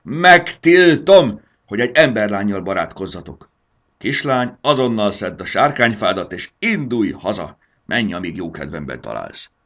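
furious dragon mother roaring a ban. Acted. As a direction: roar the forbidding word. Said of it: "üvöltötte".

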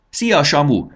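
high bright call across the yard; a girl calling a greeting loudly. Acted. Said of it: "kiáltott rá Bori".

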